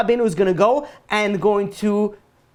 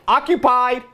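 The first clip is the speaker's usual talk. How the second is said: higher, lightly acted call; mock calling out; 6 semitones higher than usual. Rider telling another guy the roller coaster seat is taken, mock call. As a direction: call it out to ward someone off.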